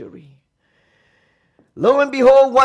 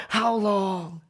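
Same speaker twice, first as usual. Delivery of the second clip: lonely cry; 6 semitones lower, 7 dB quieter than usual. Retold or imitated. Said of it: "we cried out".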